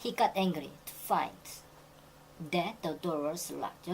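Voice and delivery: very slow, every word laid out; slow full-clause version. Shown as a demonstration. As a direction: read the long form slowly for contrast. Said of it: "that을 쓰면은 구가 되니까". (천천히 읽는 긴 문장)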